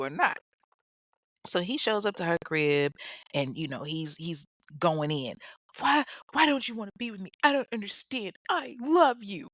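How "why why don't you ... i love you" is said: mock dramatic plea, higher and fast. Kevin pleading dramatically with Claudia to stay. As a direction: beg like a soap opera lover. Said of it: "All dramatic and shit".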